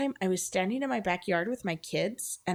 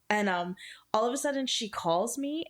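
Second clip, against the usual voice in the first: ordinary talk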